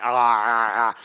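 short growling ahs like a lion guarding its prey. Retold or imitated. Pastor imitating a lion for his congregation, mock growl. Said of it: "the young lions growl over their prey".

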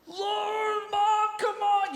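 high, loud, slow pleading whine; whiny kid. Retold or imitated. Child whining at mom in the car, loud whine. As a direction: drag out the complaint like a child.

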